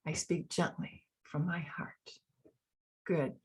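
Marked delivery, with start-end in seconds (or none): 0.1-2.1 much softer, tender mantra; speaking gently. Shown as a demonstration. Coach leading the heart mantra, very soft and tender. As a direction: speak it gently, barely above a breath. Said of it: "Join me in that".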